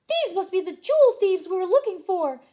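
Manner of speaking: high, loud squeaky Mickey discovery; high cartoon mouse realizing the thieves; excited Mickey. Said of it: "Mickey said to himself".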